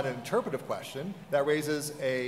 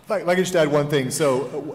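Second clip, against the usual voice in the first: much louder to grab the floor; interjecting; 9 dB louder than usual. Real, not acted. Panelist cutting in to add one thing, noticeably louder. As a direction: break in over the panel.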